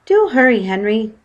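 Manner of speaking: anxious wife calling; urgent cry. Acted. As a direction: hurry her husband, alarmed. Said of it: "she cried".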